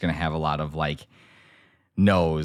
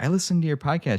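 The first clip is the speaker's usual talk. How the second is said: grandma's voice; 10 semitones higher than usual. Retold or imitated. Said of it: "she's like".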